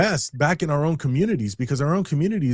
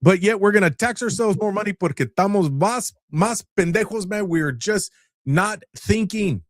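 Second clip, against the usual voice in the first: sarcastic rant; 8 semitones higher than usual. Acted. Continues sarcastic rant, Spanish mixed.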